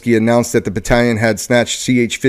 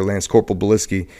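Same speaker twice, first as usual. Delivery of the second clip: ordinary delivery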